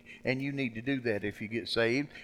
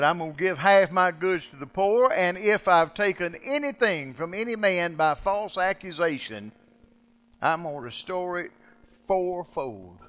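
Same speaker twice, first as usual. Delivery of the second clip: proclaiming; 7 semitones higher, 9 dB louder than usual. Acted. Voicing Zacchaeus's vow again.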